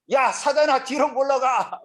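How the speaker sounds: loud, high-pitched acted shout; Jesus, shouting rebuke. Preacher as Jesus fighting back at Peter, shouting rebuke. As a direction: yell it as a furious dismissal. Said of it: "막 이렇게 해가고".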